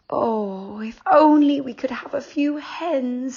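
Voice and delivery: high, wistful sigh of a tired mother. A narrator's reading. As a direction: sigh it out, longing for hens. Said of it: "sighed Mother".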